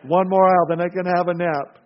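hammed-up frazzled mom coaxing herself along; worn-out mother. Preacher plays the tired mother Ellen, comic weary sigh-voice.

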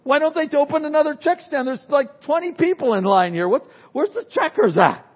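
mock shopper whining at the checkout; complaining customer. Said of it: "And you start complaining".

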